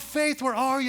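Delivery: high, urgent calling out; frantic calling. Retold or imitated. Father throwing shirts around looking for his baby, frantic shout. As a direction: shout for her while tearing through clothes. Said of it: "And I'm frantic".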